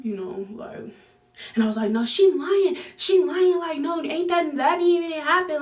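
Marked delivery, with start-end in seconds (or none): 1.9-5.6 high, loud indignant denial; mock protest. Retelling pleading with mom that the woman lied. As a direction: protest high and fast like a kid caught out.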